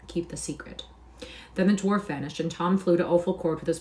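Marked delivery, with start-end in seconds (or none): 0.1-0.8 whisper. A narrator's reading. Dwarf tells secret.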